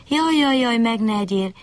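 high, wailing plea of a frightened bunny; lamenting bunny. A narrator's reading. Bunny begs the fox not to eat him, wailing high. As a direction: cry out like a scared little rabbit begging. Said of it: "siránkozott a nyuszi".

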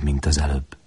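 usual speaking voice

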